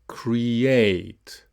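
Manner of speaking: "Create" in two clear syllables; "Create" with a long, stressed second vowel; repeat-after-me model. Shown as a demonstration. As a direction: model "Create" as cre-ATE. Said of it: "So repeat after me".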